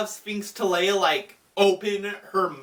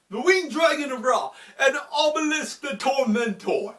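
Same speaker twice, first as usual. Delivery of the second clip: theatrical speech building higher to a proclamation; dramatic duelist; 3 semitones higher than usual. Retold or imitated. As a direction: build to a grand, hammed-up introduction. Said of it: "He's like".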